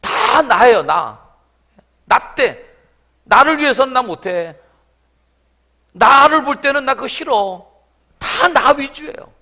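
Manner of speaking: loud, rising passionate sermon; full-force preaching. Acted. Pastor denouncing the selfish man to the congregation, fervent. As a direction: drive the point home with fervour.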